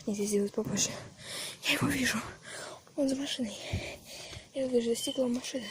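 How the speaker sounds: hushed fast whisper while hiding. Real, not acted. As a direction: whisper so he won't hear. Hiding, monster seen nearby.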